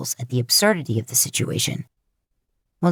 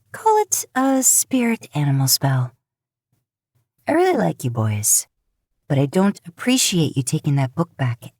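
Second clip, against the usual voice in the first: a raised, mischievous witch explaining her spell; teasing witch. Acted. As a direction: toy with him, sweetly smug. Rowena voiced, higher.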